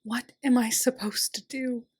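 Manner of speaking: a frightened, breathy whisper; whispered plea of despair; whimpered whisper. A narrator's reading. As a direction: barely voice it, lost and scared. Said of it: "with a pathetic whimper".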